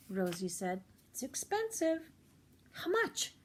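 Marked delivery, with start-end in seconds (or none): 1.1-2.1 lightly voiced clerk. Clerk warns price. 2.7-3.3 lightly voiced girl. Rosie asks price.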